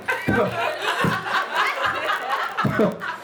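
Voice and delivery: airy high-pitched practiced laugh; a breathy, high, rehearsed giggle. Pretend. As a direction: show off the laugh you rehearsed.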